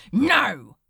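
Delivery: loud, sharp no; shouted refusal. Acted. Crowley refusing the angel, loud. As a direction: shout him down.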